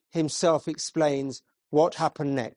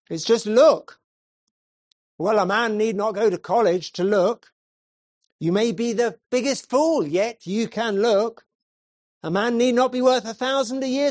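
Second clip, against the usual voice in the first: higher, louder plain-folk preacher pleading; preaching chapel preacher; 4 semitones higher, 4 dB louder than usual. Acted. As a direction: preach simply and earnestly to a small chapel. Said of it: "He began thus".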